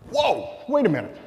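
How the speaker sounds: high-pitched whoa of surprise; sudden realization. Retold or imitated. Storyteller spots attorneys' ad on chiropractor's magazine rack, acted surprise. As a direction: stop short, struck by a discovery.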